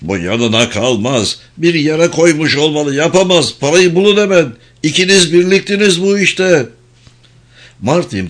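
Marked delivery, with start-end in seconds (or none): 0.0-6.7 high-pitched, mildly raised accusing voice; angry shouting. A narrator's reading. Quarrelling family all yelling about the missing money, angry shouting. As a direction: shout accusations over one another, pitched up. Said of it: "Hepsi de bir ağızdan bağırmaktaydılar".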